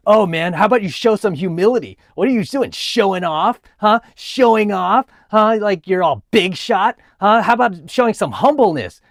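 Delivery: hammed-up angry accusing rant; lashing-out hater. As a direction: act out an indignant scolding, pushed up in pitch. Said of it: "lashed out like".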